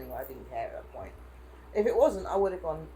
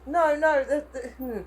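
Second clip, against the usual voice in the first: ordinary delivery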